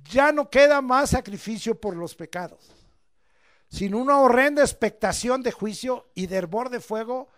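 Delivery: loud, rising preacher's cry; fiery preaching. Acted. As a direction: preach it hard to the congregation.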